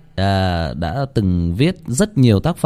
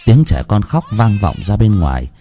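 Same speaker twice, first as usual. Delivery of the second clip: louder, measured storytelling voice; horror story narration; 5 dB louder than usual. A narrator's reading. Narrator telling listeners the creepy scene, in a dark storytelling voice. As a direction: read it like a spooky tale by night.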